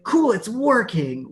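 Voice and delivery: high, loud and drawn-out mock delight; giddy. Wife cheers as her sick husband vomits, giddy. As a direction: imitate a giddy wife, slow and thrilled. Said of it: "she got giddy".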